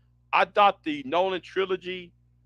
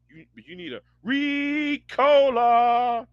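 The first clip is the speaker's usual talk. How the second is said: ordinary delivery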